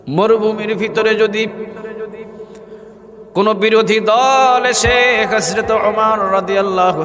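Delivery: high, drawn-out chanting delivery; melodic preaching. A narrator's reading. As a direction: intone the holy name, stretching it long. (সুর করে টেনে টেনে ওয়াজ)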